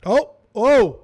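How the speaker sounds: loud, high startled oh; sharp loud excited yelps. Real, not acted. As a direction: jump at the reveal. Sudden reveal on screen.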